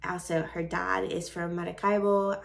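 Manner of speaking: ordinary talk, nothing marked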